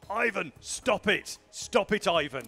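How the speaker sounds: loud, high, drawn-out exasperated shout; imitated crowd shouting. Player mimicking everyone in Portland shouting at Ivan, loud shout. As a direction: yell it slowly like someone shouting at a player. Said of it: "everyone's gonna be shouting at him".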